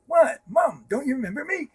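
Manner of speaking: high, loud stunned cry; a son stunned that his mom doesn't know him; shocked disbelief. Retold or imitated. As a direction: blurt it out in alarm. Mother doesn't recognize him.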